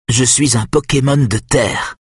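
put-on a boy announcing his secret power; satisfied boy. A narrator's reading. Nick proudly tells Kenneth he is an earth Pokémon, pleased. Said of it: "d'un ton satisfait".

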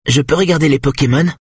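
put-on high little boy's voice; clear, high voice of a young son; clear, high-pitched child. A narrator's reading. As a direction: pitch it up like a small child piping up. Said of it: "sa voix claire et haut perché".

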